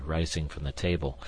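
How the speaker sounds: plain reading voice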